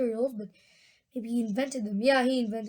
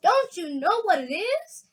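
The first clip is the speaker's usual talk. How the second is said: proud question; 7 semitones higher, 4 dB louder than usual. A narrator's reading. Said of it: "gazing proudly at the result".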